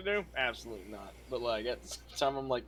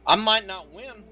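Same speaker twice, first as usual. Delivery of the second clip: defiant shout; 7 semitones higher, 5 dB louder than usual. Retold or imitated. Defiant boast about the fight.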